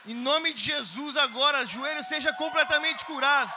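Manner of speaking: slow, loud commanding prayer; loud healing prayer. Retold or imitated. Praying over the dealer's knee, loud slow command. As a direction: declare the healing forcefully.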